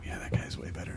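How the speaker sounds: whispered like a hypnotized fan; hushed, spellbound Yeah; awed whisper. Retold or imitated. Americans hearing a British accent, awed whisper. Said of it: "we immediately go".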